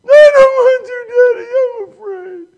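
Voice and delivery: put-on high, loud, tearful child's refusal; frightened child crying. Daughter refuses daddy from the slide top, high tearful cry. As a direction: whimper it like a scared little girl.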